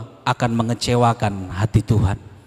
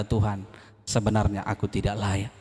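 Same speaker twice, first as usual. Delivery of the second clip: humble tearful prayer; 5 semitones lower, 6 dB quieter than usual. Retold or imitated. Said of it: "tertunduk dan menangis dan berkata".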